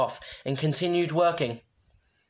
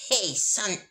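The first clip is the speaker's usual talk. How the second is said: wrong impression; 6 semitones higher than usual. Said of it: "wrong impression".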